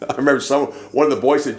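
ordinary talk, nothing marked

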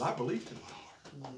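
soft drop after an outcry; hushed retold confession. Preacher retells young woman's moment of belief, hushed. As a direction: let her words fall away softly.

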